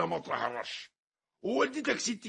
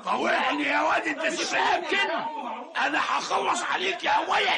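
put-on old man threatening the boy in a street brawl; threatening shout; 9 semitones higher, 4 dB louder than usual.

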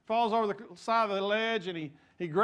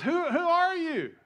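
high, slow startled cry; startled question; 12 semitones higher, 5 dB louder, slower than usual. Acted. Man on the cliff startled by the voice, high. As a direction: ask in disbelief.

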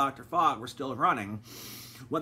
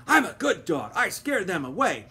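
panting; 6 semitones higher, 7 dB louder than usual. A narrator's reading. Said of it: "he panted".

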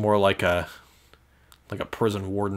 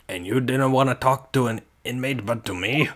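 amateur Scottish governor, questioning; attempted Scottish accent; 3 semitones higher than usual. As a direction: put on a rough Scottish accent. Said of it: "I can't do a Scottish accent".

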